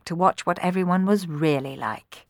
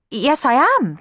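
higher, louder, mildly flustered answer; stuttering; 9 semitones higher, 5 dB louder, slower than usual. A narrator's reading. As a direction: stammer it out nervously. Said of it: "stuttered Cenerentola".

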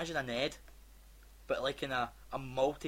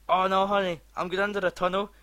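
loud, higher fake excuse; mock hurried phone goodbye; 5 semitones higher, 11 dB louder than usual. Lying to a partner about a tunnel, loud and high. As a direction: hurry off the call with a fake excuse. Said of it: "you know that bit where".

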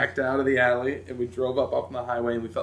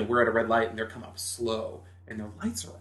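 ordinary talk, nothing marked

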